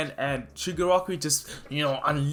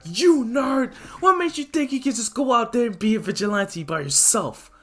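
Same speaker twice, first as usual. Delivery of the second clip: much higher, loud angry shouting; angry Bakugo; 9 semitones higher, 3 dB louder than usual. Retold or imitated. Bakugo yelling at Izuku for going alone, angry shout. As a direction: bark it at the nerd. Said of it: "And Baku's like".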